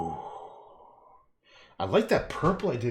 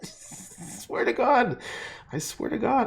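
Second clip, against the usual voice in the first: laughing; 12 semitones higher, 3 dB louder, slower than usual. Real, not acted. Laughing exasperation.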